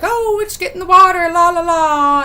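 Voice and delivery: high, singsong mock-girlish voice; airy, high-pitched mimicry trailing into la-la-la; carefree girls. Vlogger mimicking girls heading into the water, carefree and singsong.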